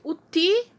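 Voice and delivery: teacher singling out the syllable "ti"; emphatic syllable. Shown as a demonstration. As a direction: pop out "ti" to show it doesn't count. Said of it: "não conta".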